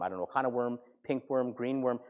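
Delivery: ordinary talk, nothing marked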